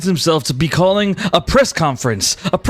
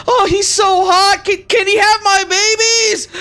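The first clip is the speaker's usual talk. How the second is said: swooning fan; 3 dB louder than usual. Acted. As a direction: squeal like a smitten admirer. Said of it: "Other people yelling and saying".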